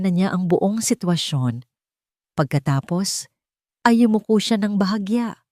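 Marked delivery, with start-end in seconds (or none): none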